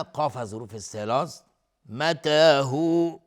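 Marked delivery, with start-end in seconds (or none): 1.9-3.2 loud chanted "متى هو" with long even vowels; natural madd. Shown as a demonstration. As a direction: hold the aa and oo of "متى هو". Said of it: "مد طبیعی است". (مد طبیعی کشیده در «متى هو»)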